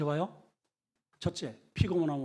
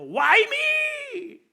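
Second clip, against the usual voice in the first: much higher shouted plea; crying out; 10 dB louder than usual. Acted. Suffering man crying to heaven, shouted plea. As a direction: hurl it at the sky. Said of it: "외치는 거예요".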